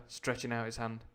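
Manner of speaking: ordinary delivery, nothing marked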